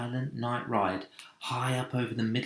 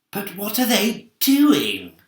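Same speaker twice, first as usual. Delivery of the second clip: ordinary delivery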